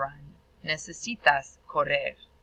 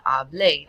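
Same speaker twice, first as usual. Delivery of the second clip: hablé with the final é stressed; stress on the end; 5 dB louder than usual. Shown as a demonstration. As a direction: land firmly on the last syllable of hablé. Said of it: "That accent tells us to stress the end".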